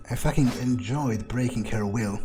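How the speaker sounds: ordinary talk, nothing marked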